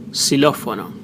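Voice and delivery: normal talking voice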